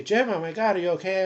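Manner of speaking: alarmed friend on the phone; retold concerned callers. Friends phoning patient in hospital, worried. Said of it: "they would say".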